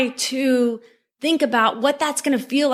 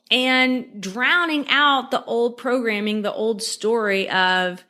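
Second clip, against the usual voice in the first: ordinary talk, nothing marked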